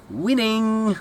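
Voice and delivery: high, drawn-out crow; gleeful catchphrase. Acted. Comic riffing on friend's nickname, gleeful crow.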